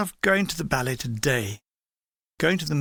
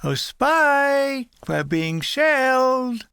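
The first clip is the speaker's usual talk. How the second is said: high sing-song cricket voice, slow; lilting, cartoonish and light; sing-song Jiminy Cricket; 8 semitones higher, much slower than usual. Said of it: "in a sing-song voice like Jiminy Cricket".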